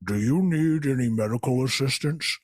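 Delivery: costumed wizard. Retold or imitated. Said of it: "a man in a wizard costume is saying".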